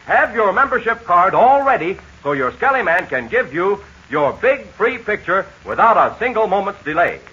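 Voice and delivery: ordinary talk, nothing marked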